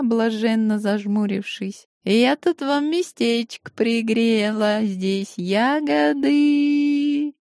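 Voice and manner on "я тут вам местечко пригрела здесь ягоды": slow high purring; cat folk. A narrator's reading. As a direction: purr it sweetly like a cat. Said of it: "замурлыкала та".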